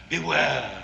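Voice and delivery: a quiet threat across the table; hushed warning. Acted. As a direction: drop to a soft, ominous beware. Ominous warning to his guest.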